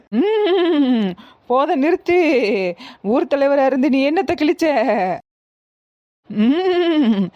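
high, swelling hum into commanding rebuke; angry possessed goddess. Acted. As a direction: rebuke him as the goddess, drawing out endings. Goddess scolds village head. (சாமி வந்து அதட்டும் குரல்)

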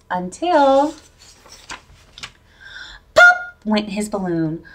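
ordinary delivery, nothing marked

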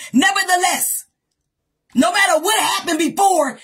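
high, shouted repeated refrain; fiery preacher's call and response; preaching at full force. Acted. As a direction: hammer each nevertheless home. Repeated nevertheless preaching run.

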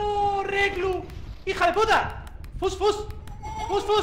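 high loud panicked yells; frantic shouting. Real, not acted. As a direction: shoo it away in panic. Shooing the monster away.

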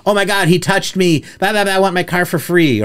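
high mock complaint, imitating a customer; whining customer.

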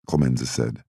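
usual speaking voice

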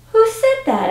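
high-pitched surprised question; taken aback, voice shooting high; startled mother. Acted. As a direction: ask in sudden surprise, pitch leaping up.

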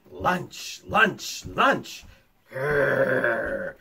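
low growling wolf; lightly voiced wolf. A narrator's reading. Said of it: "he heard".

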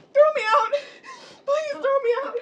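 desperate sobbing plea; crying plea. Acted. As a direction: beg through tears. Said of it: "Don't cry".

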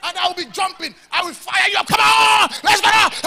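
high, loud ecstatic shouting; hype preacher shouting. Shown as a demonstration. Pastor demonstrating hype preaching, high loud shouts. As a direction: fire the crowd up, shouting high. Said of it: "I can start running like".

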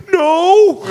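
high, loud drawn-out refusal; hammed-up yelped no; mock cry of no. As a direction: imitate a panicked man crying out. Retold defiant victim's reply.

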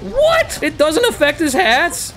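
very high, squeaky what; high, incredulous outrage; shocked disbelief. Real, not acted. Shocked the fire fails.

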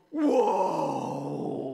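low, loud gasp of awe; awed whoa. Retold or imitated. Tourist at the canyon edge, a loud awed whoa. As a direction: let the view floor you.

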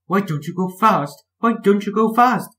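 high, loud, anxious coach voices; anxious calling. A narrator's reading. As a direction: call out in a worried high pitch. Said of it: "called Annie and Clarabel anxiously".

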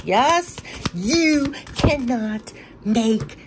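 a high, thrilled burst that slows right down; overwhelmed by what the cards show; real excitement. Real, not acted. Reader thrilled as the reading sums everything up. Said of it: "I wanna scream".

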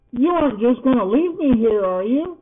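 high, loud, anxious plea; pleading. A narrator's reading. Poppy fearing being left here, pleading.

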